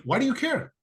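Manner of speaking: high defensive why do you care; defensive retort. Retold or imitated. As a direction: toss it back like someone who feels cornered. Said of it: "it's almost like".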